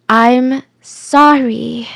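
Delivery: drawn out very slowly; repeated apology. Retold or imitated. Suspect repeating herself to investigators, slow apology. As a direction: stretch each word, as if rehearsing it. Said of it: "she just kept repeating".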